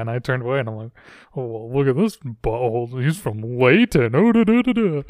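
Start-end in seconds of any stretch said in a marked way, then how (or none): 1.4-5.0 snooty mock voice, sing-song at the end; mocking pompous voice. Retold coworker mocking the speaker as pompous, snooty mock voice. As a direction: sneer like a coworker mocking a snob. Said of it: "took it as me being a pompous asshole".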